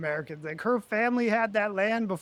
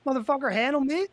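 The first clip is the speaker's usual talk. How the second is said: higher and louder, fast and fired up; hyped up; 8 semitones higher, 4 dB louder than usual. Real, not acted. Hyping up his raft standoff for his co-host, higher, louder and fired up. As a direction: pump yourself up like before a fight.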